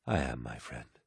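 low, soft and friendly; smiling reassurance. A narrator's reading. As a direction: reassure a friend quietly, with a smile. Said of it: "smiled Horus".

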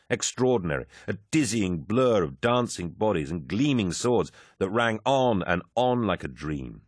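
plain reading voice

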